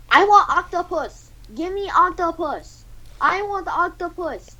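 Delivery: high, slow, demanding caricature; drawn-out high-pitched insistence; Gordon Ramsay. Acted. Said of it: "Gordon Ramsay says".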